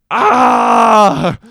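loud high exasperated "Ah!"; mock frustrated shout at the bowing rule. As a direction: let out a burst of frustration. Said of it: "and I'm like".

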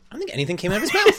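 high, fast disbelieving quote; incredulous high voice. Retold or imitated. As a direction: squeak it out in disbelief. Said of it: "I literally said".

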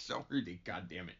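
usual speaking voice

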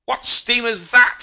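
slow, very high, loud yell; screamed demand. A narrator's reading. First mate screaming at the strange steamer, high and drawn out. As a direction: scream it across the dock. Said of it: "screamed Mahon".

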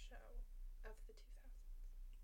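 whispered aside. Real, not acted. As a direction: drop to a whisper. Trailing aside under breath.

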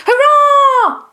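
very high, loud hurrah; full-voiced, high-pitched whoop; jubilant cheer. A narrator's reading. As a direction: squeal a long hurrah. Cheer on new king's head.

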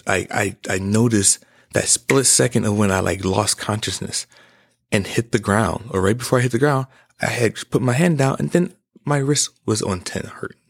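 ordinary talk, nothing marked

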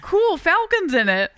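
high, loud burst of delight; mock excitement. Fan reacting to Falcon's casting, mock excitement. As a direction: stretch it out, high and thrilled. Contrasts own earlier grumble with new delight.

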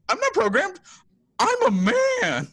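very high, drawn-out Don Knotts-like protest; Riley. Retold or imitated. Podcaster mimics unsure Riley defending himself, high wimpy mock protest. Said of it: "your go-to voice for, uh, for Riley".

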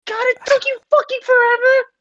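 high-pitched screaming-style voice. Acted. Demon insulting Barrett, in a very high sneering voice. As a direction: sneer it in a shrill demon squeal. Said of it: "your high pitched screaming voice".

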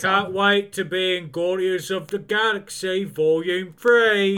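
put-on British accent, lifting at the end; Will Poulter, British accent. Host mimicking an actor excited about his film, British accent. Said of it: "Does he sound like that in real life".

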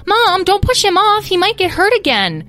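put-on very high, loud child protest; high-pitched worried squeal; alarmed little sister. A narrator's reading. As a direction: cry out to mom to protect the baby.

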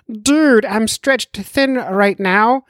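high, slow, harried doctor voice; Ryan Gray voice. Retold or imitated. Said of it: "that's how he actually sounds".

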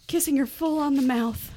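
ordinary talk, nothing marked

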